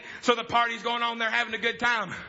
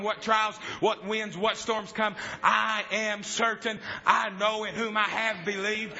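normal talking voice

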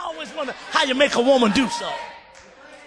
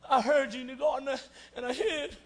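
much softer, guilty confession; sheepish Adam; 12 dB quieter than usual. Acted. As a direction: mumble it like a caught child. Adam answers God, ashamed.

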